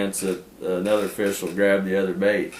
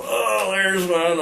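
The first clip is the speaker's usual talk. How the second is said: raised, slowed eager exclaim; high slow oh there's one; retold excitement; 9 semitones higher, slower than usual. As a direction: spot the fish with eager delight. Narrator reacting to a bite.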